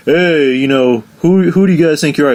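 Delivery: mock a drunk picking a fight; obnoxious drunk. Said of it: "He's like".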